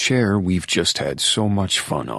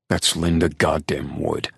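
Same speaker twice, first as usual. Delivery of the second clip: plain reading voice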